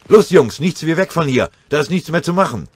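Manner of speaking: very high, loud urgent command; breathless shouting. Acted. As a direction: urge the men to flee, out of breath. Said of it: "außer Atem". (atemlos gerufen)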